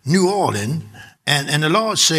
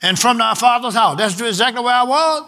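raised, solemn scripture reading; booming biblical narration; 5 semitones higher than usual. Acted. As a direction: proclaim it like King James. Reads Genesis aloud, higher.

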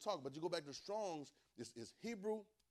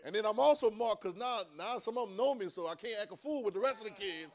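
loud, high preaching; preaching at full force; 6 semitones higher, 12 dB louder than usual. Acted. Preacher crying out to the congregation about being marked. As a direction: shout it like a Sunday peak.

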